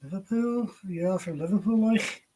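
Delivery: playful Scouse impression; Liverpool accent. Shown as a demonstration. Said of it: "So Liverpool or London?".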